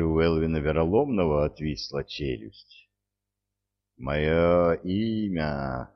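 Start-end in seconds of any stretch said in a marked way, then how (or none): 4.0-5.9 slow, rumbling voice of a god. A narrator's reading. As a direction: roll each word out slowly and grandly. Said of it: "рокотал Экин".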